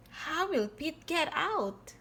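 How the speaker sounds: usual speaking voice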